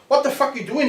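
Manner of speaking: high, indignant demand; high-pitched retold outrage; angry K-Man. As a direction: play the furious headliner confronting him. K-Man angrily confronting him.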